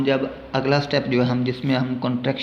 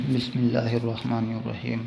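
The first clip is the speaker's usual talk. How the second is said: quiet Quranic recitation; 4 dB quieter than usual. Real, not acted. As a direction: softly recite the Arabic opening formula. Opening Quranic recitation formula. (धीमा क़ुरानी पाठ)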